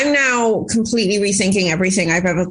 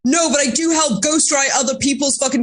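emphatic, higher mock retort; mildly heated, pitched-up comeback; retold screaming-match comeback; 4 semitones higher than usual. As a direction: fire back as in a retold argument. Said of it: "got into a screaming match with".

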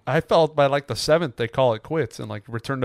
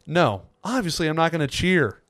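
ordinary delivery

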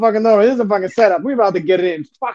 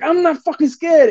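higher, slow, emphatic "I'm not fucking scared"; defiant boast; 8 semitones higher, slower than usual. Retold or imitated. To the tiger handler's son, a slow defiant high boast. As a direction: lean into every word, proving bravery. Declaring he's unafraid before tigers.

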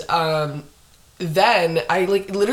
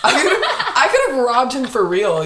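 laughing; 7 semitones higher, 4 dB louder than usual. Real, not acted. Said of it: "What an idiot".

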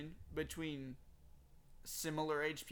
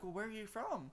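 lightly put-on higher girl voice; imitated girls' question; 6 semitones higher than usual. Girls asking the tourist his origin, higher lightly mimicked voice. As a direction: mimic a curious girl, pitched up.

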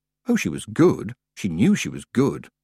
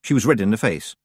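plain reading voice

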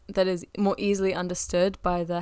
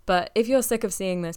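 ordinary talk, nothing marked

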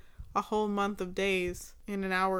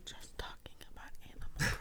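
whispering; 10 dB quieter, much faster than usual. Acted. Whispered quip back at friend.

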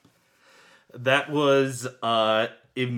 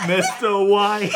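laughing Jesse voice; 9 semitones higher, 8 dB louder, slower than usual. Acted.

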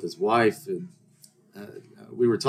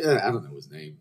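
ordinary delivery

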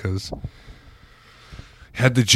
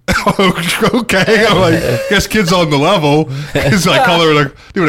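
laughing disbelief; 8 semitones higher, 5 dB louder than usual. Retold or imitated. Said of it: "And I'm like".

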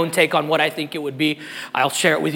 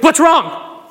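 anxious answer; 10 semitones higher, 4 dB louder than usual. Retold or imitated. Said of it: "and I'll answer with".

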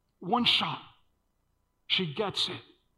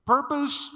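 "Purpose" called out higher and louder with a rising tune; stressed word; 8 semitones higher, 5 dB louder than usual. Shown as a demonstration. Preacher holding the key word up, high and rising. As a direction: lift "Purpose" up high and hold the question. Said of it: "I want you guys to understand here".

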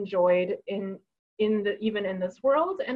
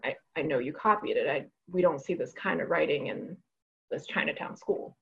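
lower, softer, a hint of a stern teacher; 4 semitones lower, 7 dB quieter than usual. Fifth-grade teacher accusing the student of copying, low and quiet. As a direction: let the accusation drop low and cold, fading to a breath.

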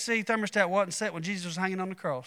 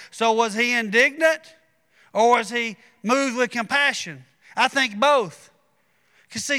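rising preacher's cadence, a bit higher; building sermon; 4 semitones higher, 4 dB louder than usual. Acted. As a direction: preach it home with growing fervor.

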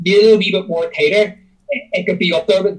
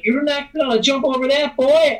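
Pepper the sheriff; 7 semitones higher than usual. Retold or imitated. Sheriff scoffing at Bond in the car, mock Southern voice. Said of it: "says something like".

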